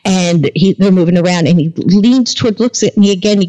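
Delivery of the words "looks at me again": plain reading voice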